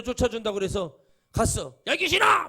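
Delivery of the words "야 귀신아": loud, high-pitched command to a demon; exorcist's shout. Acted. Exorcist shouting at a ghost to cast it out, commanding shout. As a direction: shout it out to drive the spirit away. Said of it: "막 그러면서 귀신 쫓아내".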